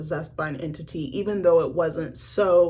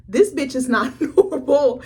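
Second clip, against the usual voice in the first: laughing disbelief; 7 semitones higher, 6 dB louder, slower than usual. Retold or imitated. Shocked at character's act.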